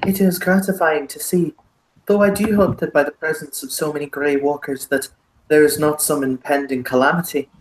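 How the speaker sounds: courtly Irish baron, formally phrased; heavy Irish, stately; thickest Irish accent. Acted. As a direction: voice polite concern in a broad Irish accent. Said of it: "the thickest Irish accent".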